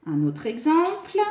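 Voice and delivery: usual speaking voice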